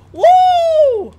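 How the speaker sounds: high, very loud whoop; triumphant whoop. Real, not acted. Player beating Minecraft, triumphant whoop. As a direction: let out one long victory cheer. Wins the game.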